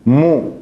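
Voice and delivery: short clear mu with dhamma; Arabic syllable mu. Shown as a demonstration. Teacher modelling dhamma sound, rounded mu. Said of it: "Pronuncio mu".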